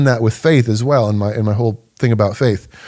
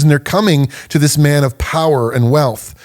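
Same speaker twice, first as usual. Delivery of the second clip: ordinary talk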